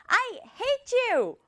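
high, drawn-out mock fury; angry outburst. Teacher acts the sister lashing out at her brother, angry outburst. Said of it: "오빠한테 이제 지율이도 막 퍼붓는 거예요".

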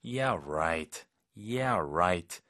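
low, drawn-out sarcasm; skeptical, stretched "right"; sarcastic. Shown as a demonstration. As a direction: drop the pitch and doubt them. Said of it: "이런 상황에서".

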